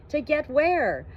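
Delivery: to get where pitched high and loud; exasperated loud question; baffled. A narrator's reading. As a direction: demand it in total confusion. Baffled narrator asks loudly.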